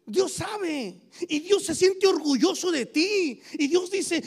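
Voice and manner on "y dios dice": normal talking voice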